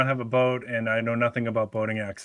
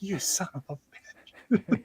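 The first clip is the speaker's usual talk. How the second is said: quiet curse; 7 semitones higher, 6 dB quieter, much slower than usual. Retold or imitated. Retold curse at possum.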